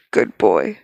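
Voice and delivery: low, smug male character voice; low teasing man. Acted. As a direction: drop deep and purr it like a smug lover. Reader voices the man's line.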